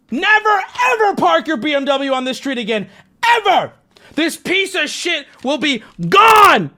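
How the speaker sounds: high, loud mock-furious yell; furious shout. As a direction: bellow it like an enraged neighbor. Acts furious note writer shouting.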